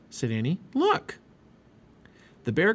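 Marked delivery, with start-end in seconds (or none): 0.7-1.2 very high little cry; excited exclamation. A narrator's reading. Girl spots cubs on snowbank, excited exclamation. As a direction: point it out with high-pitched glee. Annie points out sliding cubs.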